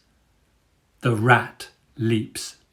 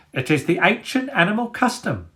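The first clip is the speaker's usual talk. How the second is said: out of breath; 9 semitones higher than usual. A narrator's reading. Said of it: "heaving for breath".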